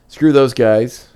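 slow, emphatic brush-off; loud dismissive. Real, not acted. Dismissing toxic fans.

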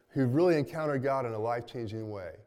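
usual speaking voice

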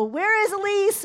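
loud, high-pitched holler; shouting out. Retold or imitated. Wife calling to husband upstairs, shouting out. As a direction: call out so another room hears. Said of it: "Or I'll shout out".

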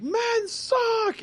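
high mock yell; imitated yell. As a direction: squeal it like she yelled in the pool. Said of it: "she was, like, yelling".

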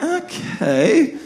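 a laughing, high okay. Real, not acted. Pastor laughing at the driver's scheme. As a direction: laugh through the word in disbelief. Driver diverts them to shop.

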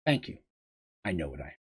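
hushed and low, almost to herself; quiet. Acted. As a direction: murmur it softly. Princess's quiet resolve.

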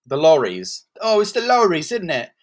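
ordinary delivery

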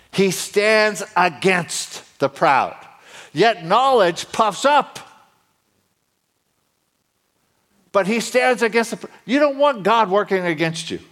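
high, forceful preacher voice; emphatic preaching. Real, not acted. Preacher warning the congregation about pride, emphatic high preaching. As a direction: hammer it home from the pulpit.

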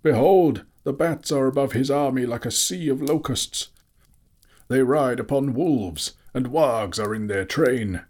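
high, loud, urgent proclamation; thunderous warning. A narrator's reading. Wizard warning the armies of goblins, thunderous. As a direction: call out a dire warning. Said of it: "he called in a voice like thunder".

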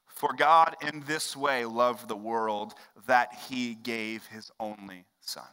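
slow, measured reading of the verse; scripture reading. Acted. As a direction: read the verse deliberately. Reading John 3:16 aloud.

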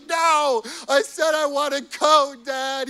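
high and loud through tears; tearful child protest. Retold or imitated. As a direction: insist through sobs like a frustrated boy. Crying son corrects the joke.

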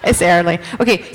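ordinary talk

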